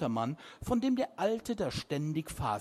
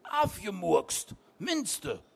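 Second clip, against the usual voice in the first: horrified cry; 7 semitones higher, 3 dB louder than usual. A narrator's reading. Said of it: "rief er entsetzt".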